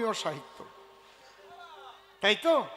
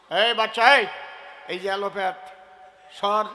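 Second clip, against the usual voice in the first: put-on scolding a child; 5 dB louder than usual. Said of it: "আমি কি সুন্দর করে মা মা বলে বলতেছি".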